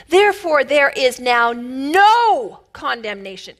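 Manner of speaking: slow preached stress on "no"; emphatic no. Shown as a demonstration. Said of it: "it's actually talking emphatically".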